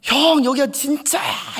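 high, slow, loud shout; yelling hoobae. Retold or imitated. Junior shouting the news down the phone, high slow yell. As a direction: shout it out, beside yourself. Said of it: "고래고래 소리를 지르더라고요".

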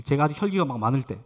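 ordinary talk

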